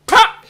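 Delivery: sudden high, loud "pa" smack noise; imitated slap. Mom slaps child in the story, loud imitated slap. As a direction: crack it out as one loud syllable.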